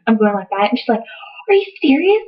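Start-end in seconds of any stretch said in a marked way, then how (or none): 1.5-2.2 high-pitched astonished mom; mock disbelief. Mom hearing daughter's news, imitated disbelief. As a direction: imitate a mom's incredulous question, pitched way up.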